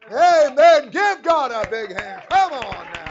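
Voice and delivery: loud, high call to praise; rousing the church. Real, not acted. Preacher urging the church family to applaud, loud and rousing. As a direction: stir them up to clap.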